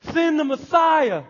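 a desperate shout to heaven; loud, high send the Messiah; loud desperate plea. Acted. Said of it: "heart of a believer".

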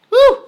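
high, loud celebratory whoop; excited whoop. Real, not acted. Preacher whooping to the congregation, excited. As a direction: let out a joyful preacher's woo. Preacher exults mid-sermon.

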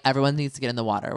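fast hurried command from the guides; rushed order. Retold or imitated. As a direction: rattle it off fast like a pushy guide. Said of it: "And they're like".

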